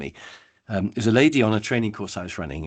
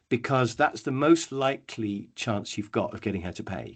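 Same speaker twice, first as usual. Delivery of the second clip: usual speaking voice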